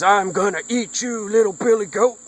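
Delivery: high-pitched, put-on troll voice; menacing troll. Troll threatens littlest goat crossing the bridge, menacing high troll voice.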